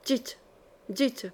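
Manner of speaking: supplication très douce, plus faible; palpitante, dans un souffle. A narrator's reading. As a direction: presser l'autre de parler, presque sans voix. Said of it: "palpita Odette". (prière haletante et douce)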